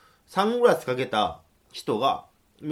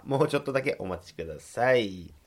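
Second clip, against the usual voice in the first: usual speaking voice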